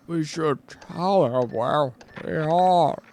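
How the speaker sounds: slow, stretched mimic of the echo; slow motion speech. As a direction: drag each word like slowed tape. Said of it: "is he talking in slow motion?".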